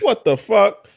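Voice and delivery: a startled what-the-fuck at his own stomach; shocked outburst. Retold or imitated. Telling friends about his upset stomach, mock shocked outburst. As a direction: jump way up high, half-laughing in disbelief. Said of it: "I'm like".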